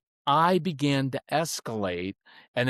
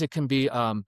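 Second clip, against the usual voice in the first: ordinary talk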